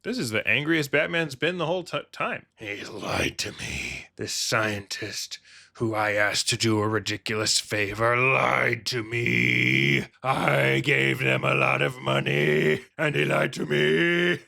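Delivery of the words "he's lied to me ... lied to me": slow, low brooding vow; angry Batman voice. Pretend. Batman brooding about the lying scientist, slow and grim. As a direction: drag out lied to me with menace.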